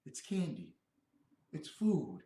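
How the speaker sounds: ordinary talk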